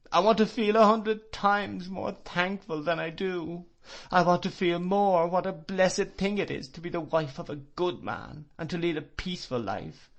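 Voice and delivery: high, desperate sobbing supplication; anguished pleading. Acted. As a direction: beg everyone for help in grief. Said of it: "this supplication ... agony of grief".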